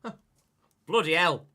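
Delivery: loud, high burst of shock; surprised exclamation. Real, not acted. Streamer reacting to a wild wheel spin, loud shocked outburst. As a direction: blurt it out in disbelief.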